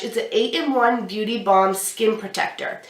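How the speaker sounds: ordinary talk, nothing marked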